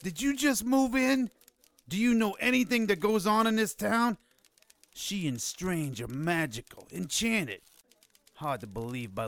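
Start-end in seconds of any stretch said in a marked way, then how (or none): 0.0-4.2 incredulous. Acted. Scoffs at newcomer's ignorance.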